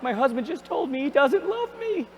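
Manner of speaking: hammed-up teary wife; mock crying. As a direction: whimper it like a hurt wife. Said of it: "the person's crying".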